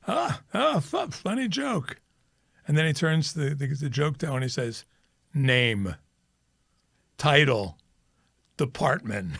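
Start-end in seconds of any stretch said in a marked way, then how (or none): 0.1-2.0 very high, slow, strained chuckle; a boss faking amusement, menacing; hollow mock laughter; forced laugh. 5.3-9.3 an officer coldly taking down names; curt official order; stern demand. Retold or imitated. Boss demands details.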